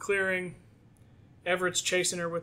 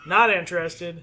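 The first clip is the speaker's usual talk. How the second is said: louder, laughing through the line; laughing tease; 10 dB louder than usual. Acted. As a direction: tease her while you laugh. Everett teasing her, laughing.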